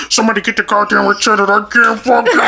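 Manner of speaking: high-pitched hammed-up panic; panicked plea. Stuck rider begging for the attendant, mock panic. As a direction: imitate a desperate, strained cry for help. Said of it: "But then it was like".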